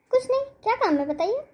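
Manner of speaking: high, fast impatient snap; irritated reply. Acted. Daughter-in-law brushes off her mother-in-law, high and fast.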